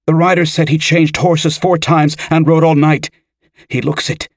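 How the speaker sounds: put-on high, urgent teenage boy; keyed-up young messenger; anxious reporting. A narrator's reading. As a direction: blurt the news, pitched well up. Anxious boy delivers the urgent message.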